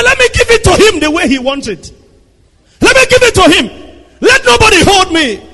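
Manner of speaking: high, loud indignant outburst; mock defiant wife. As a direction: play a wife spoiling for a fight, loud and high. Wife itching to fight back.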